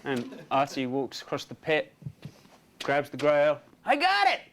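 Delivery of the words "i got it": high, excited acted call; triumphant cry. Artie having grabbed the grail, high excited cry.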